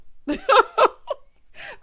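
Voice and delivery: high, loud cackle of amusement; loud high laugh. Real, not acted. Recapper tickled that she isn't fooling him, loud laugh.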